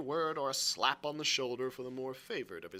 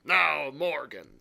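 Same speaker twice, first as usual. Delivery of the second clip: slow, loud, stern address; 8 dB louder, slower than usual. A narrator's reading. Long John questioning his drinker Morgan, very stern. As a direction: call him to account sternly. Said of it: "said Long John very sternly".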